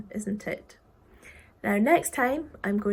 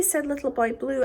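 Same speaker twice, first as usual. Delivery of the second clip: ordinary delivery, nothing marked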